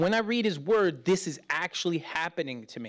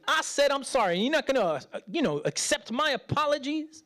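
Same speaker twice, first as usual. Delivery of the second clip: higher, hurt, protesting voice; mock indignation; 8 semitones higher, faster than usual. Son protesting to his mother, higher and indignant. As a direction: play the offended son.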